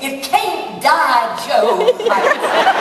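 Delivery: slow, high, loud Southern belle melodrama; deliberately awful drawn-out Southern accent; bad Southern belle. Shown as a demonstration. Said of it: "So my southern accent, my southern belle, was about as bad as you can imagine".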